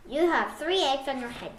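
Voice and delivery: ordinary delivery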